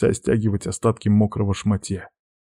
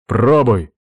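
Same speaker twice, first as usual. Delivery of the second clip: short, loud nervous bark; barked order; 2 semitones higher, 5 dB louder than usual. A narrator's reading. Man ordering the witch to test the bath, barked. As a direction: bark it as a command. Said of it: "рявкнул я".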